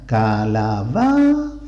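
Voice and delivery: ca-la-BAAA-za, "calabaza" in slow separate beats; stress on penultimate syllable. Shown as a demonstration. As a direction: stretch the BA in "calabaza". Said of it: "en la penúltima posición".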